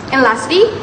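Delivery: normal talking voice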